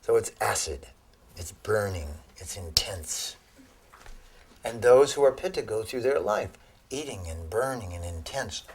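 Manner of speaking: ordinary delivery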